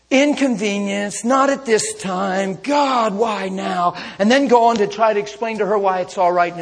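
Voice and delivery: mock the self-righteous complainer loudly; mock angry churchgoer. Preacher mocks a churchgoer fuming over his truck, loud rant.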